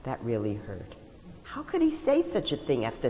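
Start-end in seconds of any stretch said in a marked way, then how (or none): none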